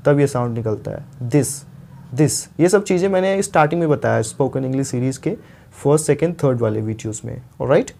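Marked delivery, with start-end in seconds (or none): 1.3-2.5 this with the tongue between the teeth; th demo. Shown as a demonstration. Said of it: "दोनों दांतों के बीच में आपकी टंग रहेगी".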